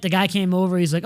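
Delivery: usual speaking voice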